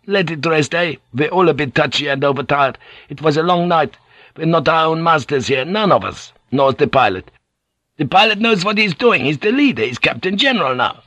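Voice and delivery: high, pacifying crewman's voice; placating Van Neck. Acted.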